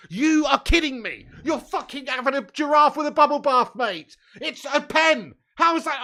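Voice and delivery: loud, high-pitched protest at the referee; real fan outrage over a denied penalty; outraged shout. Real, not acted. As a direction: yell in disbelief at the decision. Outraged at penalty not given.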